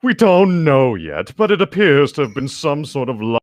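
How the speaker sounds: a touch of grave vampire gravity; understated solemn delivery; solemn Edward. A narrator's reading. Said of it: "a solemn but brooding look on his face".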